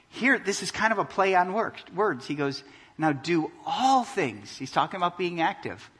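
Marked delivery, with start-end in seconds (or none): 3.0-4.6 slow "all things" with "all" held; stress on all. Shown as a demonstration. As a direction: lean into "all", slowly. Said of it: "Underline all things".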